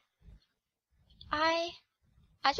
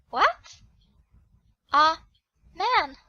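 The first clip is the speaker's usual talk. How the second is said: startled what; 10 dB louder, slower than usual. Acted. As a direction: jump out of your skin on it. Man falling from the sky.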